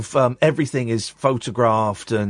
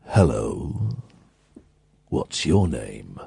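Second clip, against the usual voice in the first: much lower and very slow, a grand booming Doctor; slow deep voice; 14 semitones lower, 4 dB quieter, much slower than usual. Retold or imitated. Tom Baker greeting a small boy, deep and slow. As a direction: lean low and take your time like a towering Time Lord. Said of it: "He said it in this slow, deep voice".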